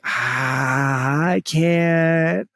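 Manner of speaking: drawn-out, strained mock protest; mock helpless. Improv student told to do the accent, mock helpless. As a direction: stretch it into a helpless wail. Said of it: "I just can't, I can't do it".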